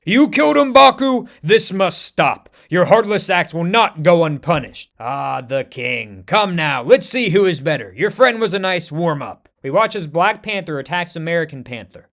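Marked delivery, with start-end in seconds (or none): 0.0-4.9 loud, furious accusation; enraged scream. Acted. T'Challa screaming at American Panther, furious. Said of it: "unleashing the kinetic energy and screams". 5.0-9.5 taunting villain. Acted. American Panther taunting the king, mild swagger.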